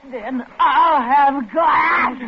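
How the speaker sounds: slow sobbing yell; crying shout. Acted. Madwoman shouts back while sobbing. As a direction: spit it out in desperation.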